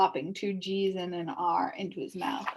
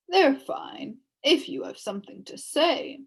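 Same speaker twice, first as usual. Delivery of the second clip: plain reading voice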